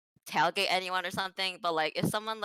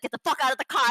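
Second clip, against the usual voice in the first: higher-pitched imitation of an angry order; retold angry order; 6 semitones higher, 4 dB louder, faster than usual. Imitating angry driver confronting a woman, heated. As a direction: act out the furious driver, half strength. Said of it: "he was so angry".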